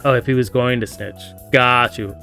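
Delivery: ordinary talk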